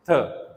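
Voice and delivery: "थ" as a dental stop with a strong puff of air; "थ" said loud, with heavy aspiration; aspirated stop. Shown as a demonstration. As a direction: blow the air out hard on "थ". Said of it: "we produce a strong puff of air".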